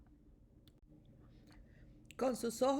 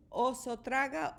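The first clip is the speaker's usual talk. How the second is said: ordinary delivery, nothing marked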